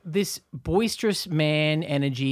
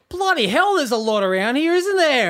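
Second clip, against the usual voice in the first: high, loud blokey outburst; booming bloke changing the subject; boisterous man; 11 semitones higher, 6 dB louder than usual. Retold or imitated. A man on the bus brushes off his tears, loud and high. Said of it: "And he went like".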